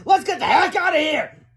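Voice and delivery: high, loud escape call; urgent cry. A narrator's reading. Freed woman urges escape, crying out loudly. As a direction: yell it in a panicky high voice. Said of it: "cried Miss Anthorp".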